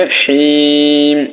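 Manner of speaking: "হিম" with the vowel held very long; long sustained "হিম", closing on m; three-alif elongation. Shown as a demonstration. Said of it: "তিন আলিফ টানিয়ে".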